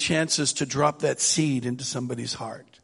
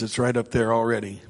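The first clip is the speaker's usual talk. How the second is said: usual speaking voice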